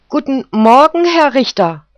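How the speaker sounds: the poodle chirps his hello up high; eager poodle greeting. Acted. Defence poodle greets the judge, high and eager. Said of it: "grüßte er".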